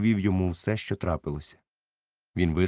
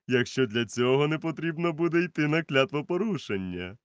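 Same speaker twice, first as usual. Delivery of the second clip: higher voice, stern doctor; doctor advising; 7 semitones higher, 5 dB louder than usual. A narrator's reading. Doctor warns Silver, higher and firm. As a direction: give a warning with authority.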